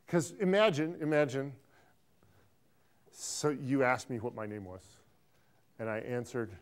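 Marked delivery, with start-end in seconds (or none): none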